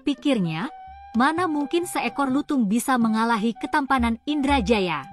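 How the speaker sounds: ordinary delivery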